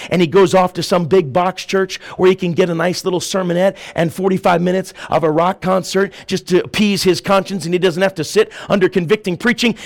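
ordinary talk, nothing marked

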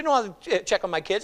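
usual speaking voice

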